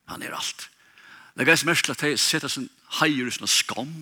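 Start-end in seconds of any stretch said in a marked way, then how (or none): 0.1-0.7 whispered repeat. Real, not acted. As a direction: whisper "Han er alt" much softer, almost unvoiced.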